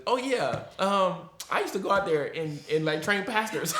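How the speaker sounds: high, loud playful impression of the pastor; imitating Pastor Dennis. Friend mimics the pastor greeting Lorne, hammed-up high voice. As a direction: chirp it out high and bright like him, laughing. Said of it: "all he remembers is".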